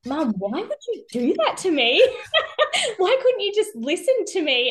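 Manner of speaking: put-on high, laughing child's protest; daughter's voice. Daughter asking her mum, high and laughing. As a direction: plead like an indignant six-year-old.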